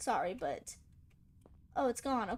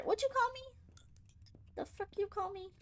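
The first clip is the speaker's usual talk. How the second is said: mock offended squeak, high; mock outrage; 8 semitones higher than usual. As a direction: pretend to be insulted. Mock challenge to the game.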